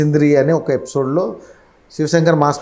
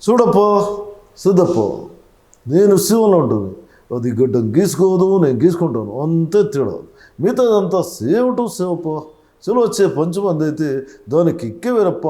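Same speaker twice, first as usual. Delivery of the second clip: Pawan Kalyan impression; 5 semitones higher than usual. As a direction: toss off the lines with cocky attitude. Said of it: "అదే పవన్ కళ్యాణ్".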